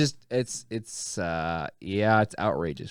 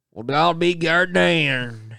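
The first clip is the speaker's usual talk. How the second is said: slow, higher Southern drawl; Southern accent; 5 semitones higher, 3 dB louder than usual. Retold or imitated. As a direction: drawl it like a Mississippi local. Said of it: "they're like".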